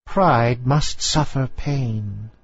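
put-on very slow, stern old lady voice; old grandmother. A narrator's reading. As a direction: lay down the rule like an imperious aunt. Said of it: "replied the old lady".

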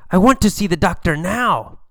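loud sick whine; loud protest. Acted. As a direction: push back hard at the nurse. Sick patient protests to nurse.

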